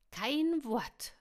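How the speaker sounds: high, loud warning shout; calling from afar. A narrator's reading. Lina warns Luschen and Will from afar, calling out. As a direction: call it out before they can comment. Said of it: "rief sie schon von weitem".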